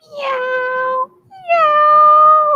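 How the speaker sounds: very high, tiny squeaky meow; tiny kitten meow. Acted. Teacher voicing the tiny orange kitten, a squeaky mew. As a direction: make the littlest mew you can. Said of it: "what she says".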